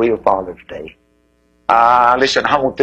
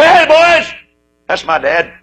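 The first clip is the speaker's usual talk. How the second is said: proud call; 13 semitones higher, 5 dB louder, slower than usual. Pretend. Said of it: "point with pride and say".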